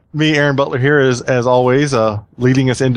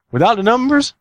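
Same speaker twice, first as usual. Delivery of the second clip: high, questioning mock-Italian voice; pitched-up cartoon Italian accent; joke Italian accent; 10 semitones higher than usual. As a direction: play the puzzled Italian with a hammy accent. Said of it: "the Italian says".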